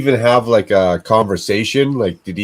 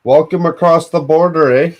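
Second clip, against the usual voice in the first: mock Canadian; 7 semitones higher than usual.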